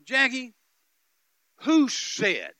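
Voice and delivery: very loud, high, indignant challenge; angry outburst. Retold or imitated. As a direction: thunder it out in re-enacted outrage. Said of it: "it made me so angry".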